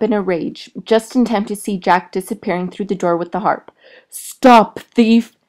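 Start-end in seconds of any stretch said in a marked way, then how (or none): 4.1-5.3 loud furious roar after a thief; giant's roar. A narrator's reading. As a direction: roar it after the fleeing boy. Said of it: "the giant roared".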